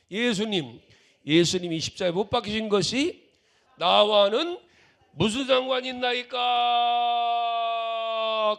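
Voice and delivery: high, booming cry with a long held ending; full-volume mock plea; loud, joking shout. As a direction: holler it playfully, stretching out the last word. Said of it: "큰 소리로 장난처럼".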